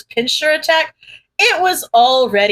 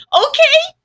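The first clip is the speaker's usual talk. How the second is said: high squeaky okay; squeak; 3 dB louder than usual. Acted. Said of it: "Izuku squeaked".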